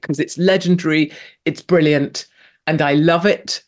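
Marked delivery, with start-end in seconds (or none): none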